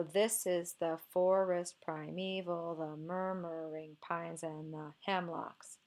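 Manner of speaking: slow, softening scansion with beats on THIS, FOR, ME, MUR, PINES, HEM; slow DUM-da-da reading from "This" down to a quiet "hemlocks"; dactylic feet. Shown as a demonstration. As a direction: mark each foot's first syllable, slowly. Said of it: "let's see it with the feet marked".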